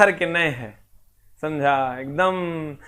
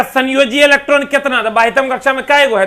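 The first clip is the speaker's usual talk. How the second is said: higher, louder exam-style question; teacher voices the exam question, higher and louder; quizzing question; 8 semitones higher, 7 dB louder than usual. Retold or imitated. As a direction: fire the question at the class. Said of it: "अब कहेगा".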